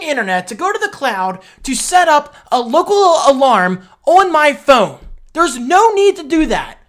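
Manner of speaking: high, loud exasperated yelling at the phone; heated rant. Retold or imitated. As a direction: let the frustration boil over. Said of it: "I'm getting heated".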